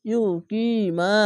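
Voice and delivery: very slow drawn-out recitation; Quran recitation. Shown as a demonstration. Teacher showing students the recited word, slowly drawn out. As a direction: stretch each syllable like tajweed. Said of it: "এক আলিফ টেনে পড়বেন".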